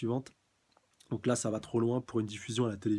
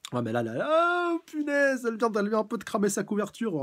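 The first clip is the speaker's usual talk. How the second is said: shocked excitement; 11 semitones higher, 6 dB louder than usual. Real, not acted. Reader shocked that Natcha blew her cover, high and loud. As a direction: gasp it out in disbelief.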